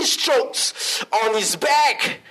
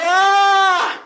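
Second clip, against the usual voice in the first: loud, high, drawn-out "aah"; pained scream; 10 semitones higher, 6 dB louder than usual. Retold or imitated. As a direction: re-enact a long scream of pain. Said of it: "and I was screaming".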